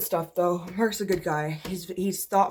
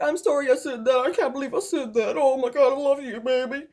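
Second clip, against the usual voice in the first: mock apology; 6 semitones higher than usual. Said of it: "he'd literally obviously be like".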